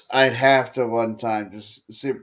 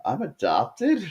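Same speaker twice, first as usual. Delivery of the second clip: higher-pitched mock disbelief; kid's shocked question; 5 semitones higher than usual. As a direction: ask it like a bewildered kid. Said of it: "turns around to his dad and goes".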